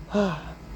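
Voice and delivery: soft exhaled sigh; relieved sigh. Retold or imitated. Brother just down from the burning tree, relieved sigh. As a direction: breathe out softly in relief.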